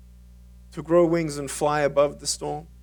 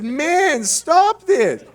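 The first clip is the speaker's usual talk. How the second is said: mock protest; 12 semitones higher, 6 dB louder, much slower than usual. Retorting to friend.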